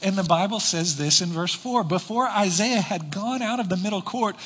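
usual speaking voice

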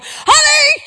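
high panicked scream of the name; screaming for husband. Pretend. Woman at the door screams for her husband, high and panicked. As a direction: shriek for help at the door. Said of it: "you'd be screaming".

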